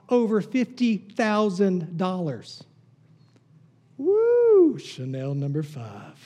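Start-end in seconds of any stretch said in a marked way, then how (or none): 4.0-4.8 1 excited whoop. Real, not acted. Reacting to perfume price.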